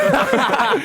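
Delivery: shrieking laugh at the punchline; high squeal of laughter. Real, not acted. Daughter cracking up mid-story, high laughing squeal.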